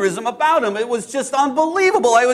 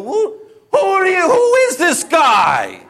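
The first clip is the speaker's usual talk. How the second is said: higher, louder shout of a hot-tempered fisherman, slowing at the end; angry shout; 8 semitones higher, 4 dB louder, slower than usual. Acted. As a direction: bellow it at the stranger in your boat. Hot-tempered fisherman confronts Jesus.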